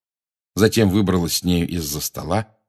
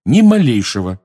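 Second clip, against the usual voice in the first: louder, higher emphatic follow-up; loud denial; 4 semitones higher, 7 dB louder than usual. A narrator's reading. Harry capping his denial to Umbridge, loud. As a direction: hit it hard and firmly. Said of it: "громко сказал Гарри".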